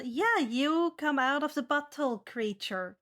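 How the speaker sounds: normal talking voice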